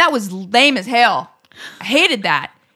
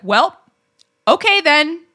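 curt dismissal; 7 semitones higher, 6 dB louder, slower than usual. Retold or imitated. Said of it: "I was like".

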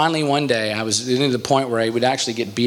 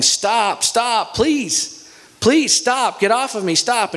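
high-pitched pleading, imitating a beaten kid; mock yelling; 10 semitones higher, much slower than usual. Bully pinned underneath begging his attacker, mock yelling. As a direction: plead high and urgently, without real shouting. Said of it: "he's underneath me yelling".

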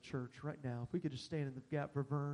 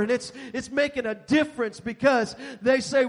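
loud, driving preacher voice; preaching at full force; 8 semitones higher, 13 dB louder than usual. Real, not acted. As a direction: preach it out to the whole room. Preaching to the congregation.

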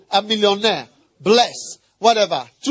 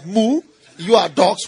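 normal talking voice

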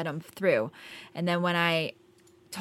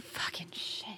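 whispered curse; 12 dB quieter than usual. Acted. Muttered curse while transferring.

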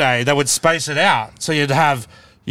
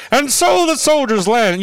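put-on slow, much higher old-time newsreel announcer; old newsreel narrator; 10 semitones higher, 3 dB louder than usual. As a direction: announce like vintage war footage narrator. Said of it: "the old footage when the accents were still".

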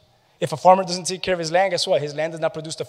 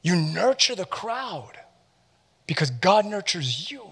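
slow emphasis; 4 semitones higher, slower than usual. Shown as a demonstration. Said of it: "slow emphatic restatement".

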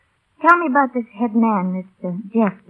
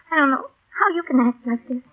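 tearful; 6 semitones higher, 4 dB quieter than usual. Acted. Grieving the dead pilot.

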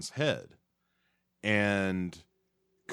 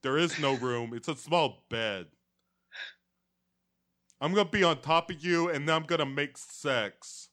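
high, loud deadpan lover; macho James voice; 6 semitones higher, 3 dB louder than usual. Acted. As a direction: boom it like a clumsy lover.

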